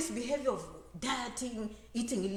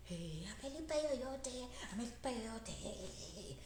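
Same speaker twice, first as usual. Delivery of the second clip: relatives gossip; 12 dB quieter, slower than usual. Retold or imitated. Relatives gossiping about paying.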